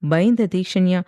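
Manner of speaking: plain reading voice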